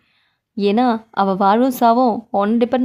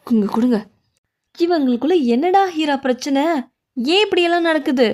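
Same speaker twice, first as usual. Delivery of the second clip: ordinary delivery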